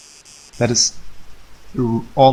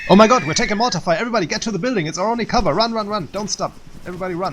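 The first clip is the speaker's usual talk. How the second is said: high, fast mock panic yells; hammed-up alarm under fire; panicked shouting; 9 semitones higher, faster than usual. Instructor fakes a mortar attack.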